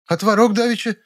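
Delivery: very high, protesting woman; indignant. A narrator's reading. Nyura goes on complaining about the curd, indignant. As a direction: keep grumbling in a high voice. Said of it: "продолжила возмущаться".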